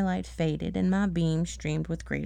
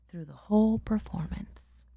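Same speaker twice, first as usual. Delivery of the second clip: whisper. Real, not acted. Sudden whispered line.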